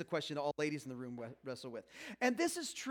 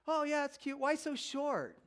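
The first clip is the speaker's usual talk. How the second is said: mock clueless compliment; 8 semitones higher, slower than usual. Voicing his clueless reply.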